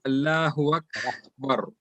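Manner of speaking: "Akbar" with a flowing K, brief airy gap before "bar"; flowing hams. Shown as a demonstration. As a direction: let the K in "Akbar" breathe out, then "bar". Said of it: "a little bit flowing".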